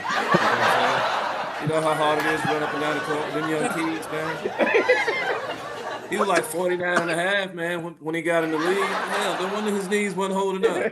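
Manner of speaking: laughing through the joke; chuckling while joking. Real, not acted. Joking Oden looked old.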